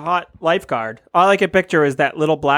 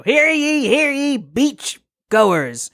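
town crier; 7 semitones higher, slower than usual. Acted. Get ready to do a sermon.

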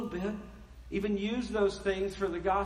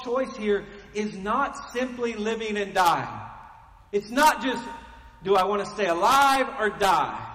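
louder, slow, emphatic preaching; preaching at full force; 2 semitones higher, 5 dB louder than usual. Real, not acted. Pastor pressing the congregation on Christ in life and death, loud and emphatic. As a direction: drive each phrase home from the pulpit.

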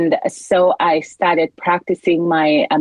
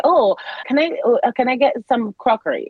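polite request; 6 semitones higher than usual. Retold or imitated. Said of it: "So say".

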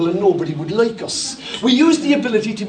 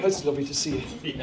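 much lower and softer, quick and withdrawn; cold distant greeting; 9 semitones lower, 10 dB quieter, faster than usual. Acted. Pastor miming someone dodging a hug, low and quiet. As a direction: back away with a mumbled nicety. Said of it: "this is the way that you create distance".

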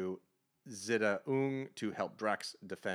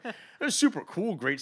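excited; 10 semitones higher, 6 dB louder than usual. Real, not acted. Excited fan praise.

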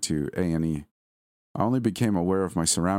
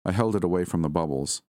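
ordinary delivery, nothing marked

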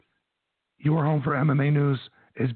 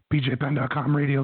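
normal talking voice

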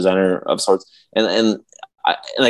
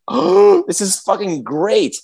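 high, delighted gasp that settles slower; thrilled gasp; 9 semitones higher, slower than usual. Retold or imitated. To his cohost, reliving the movie opening with high delight. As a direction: gasp with joy, then savour the words. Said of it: "and I'm like, gasps".

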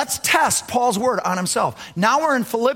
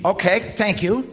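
ordinary delivery

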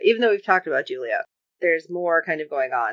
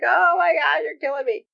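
1 mock anguish; 8 semitones higher than usual. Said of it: "And I was like".

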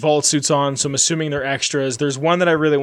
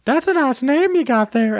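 high, creepy cartoon pervert impression; Herbert the pervert; 10 semitones higher than usual. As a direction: pipe up high like a leering cartoon character. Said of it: "doing the Herbert the pervert voice".

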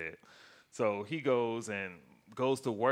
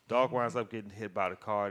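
usual speaking voice

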